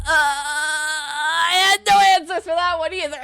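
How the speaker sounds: mock wail. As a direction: whine it out like a defeated lawyer. Admitting he has no answers.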